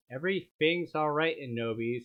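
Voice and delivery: usual speaking voice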